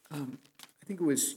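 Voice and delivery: normal talking voice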